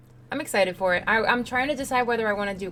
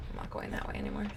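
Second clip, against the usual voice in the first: hushed whisper to stay hidden; 8 dB quieter, faster than usual. Real, not acted. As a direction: whisper it under your breath. Hiding from Pyramid Head in game.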